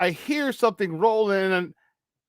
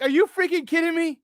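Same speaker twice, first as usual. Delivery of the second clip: disbelief; 10 semitones higher than usual. Retold or imitated. Repeat of the disbelief line.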